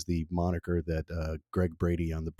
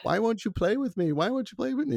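much higher mock yell of a small kid; imitating a kid's scream. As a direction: imitate a child's pleading yell, pitched way up. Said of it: "I used to scream at them".

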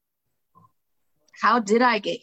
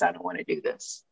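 trailing off quietly; fading to quiet; 7 dB quieter than usual. Retold or imitated. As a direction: let it fade almost to nothing. Self-talk before quitting drinking.

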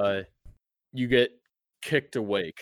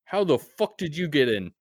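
higher, louder demand; angry guard; 7 semitones higher, 7 dB louder than usual. Acted. Guard on the wall demanding how the player got in, angry. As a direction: snap the question down at him. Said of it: "and he says".

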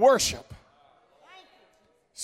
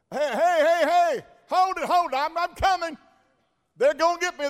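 calling out; 8 semitones higher than usual. Acted. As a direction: shout after passers-by who are leaving. Beggar calls out to Peter, John.

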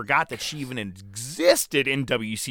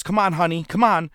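higher, louder mock cheer of the valet; 5 semitones higher, 5 dB louder than usual. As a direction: clap her on like a ringside valet, lightly hammed. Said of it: "she's clapping and she goes".

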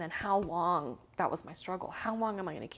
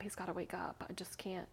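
breathy whisper, near tears; desperate whispered repeat; whispered plea; 8 dB quieter than usual. Retold or imitated. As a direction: whisper it as if at his bedside. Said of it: "I kept crying".

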